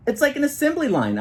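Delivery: high, bright-eyed exclamation; eager kid discovering a trick; excited exclaim. A narrator's reading. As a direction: burst out with a new idea. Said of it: "I exclaim".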